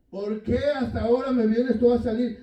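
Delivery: indignant retort. Retold or imitated. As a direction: throw it back at him, offended. Wife snapping back at husband.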